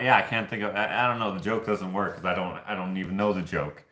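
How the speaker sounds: usual speaking voice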